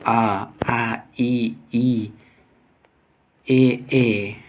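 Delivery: आ, ई, ए sounded singly with unrounded lips; unrounded vowel demo. Shown as a demonstration. Teacher showing students unrounded vowels, demonstrative. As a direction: draw out each vowel, lips spread. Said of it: "ओठ गोलाकार न होकर".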